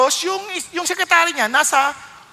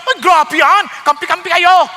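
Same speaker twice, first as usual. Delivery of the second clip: mock offended; 4 semitones higher, 8 dB louder than usual. Mimicking an offended wife.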